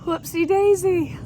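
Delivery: high, slow singsong "Whoopsie daisy"; playful high-pitched exclamation; sing-song whoopsie daisy. Acted. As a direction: stretch it out, way up high. Catchphrase sung out high.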